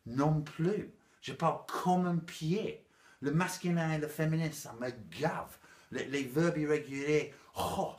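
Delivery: mock-annoyed outburst with stammered starts; hammed-up exasperation. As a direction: play up being fed up, loudly. Hammed-up exasperation at French grammar.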